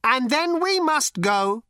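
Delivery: slow, dead-serious machine voice; serious robot. A narrator's reading. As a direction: state it in total seriousness. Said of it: "in all seriousness".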